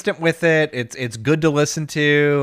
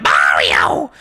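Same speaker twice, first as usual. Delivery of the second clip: Toad; 11 semitones lower than usual. Retold or imitated. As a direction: imitate a frantic squawking sidekick. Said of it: "Toad showing up out of nowhere".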